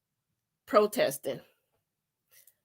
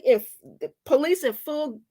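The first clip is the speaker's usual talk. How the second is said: ordinary delivery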